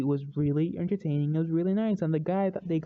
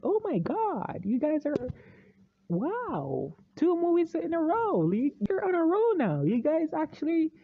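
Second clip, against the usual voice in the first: high-pitched retold amazement; amazed congratulations, voice swooping up high; impressed amazement; 9 semitones higher than usual. Vlogger reliving praise for DC's winning run, impressed amazement. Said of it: "And, and I was like".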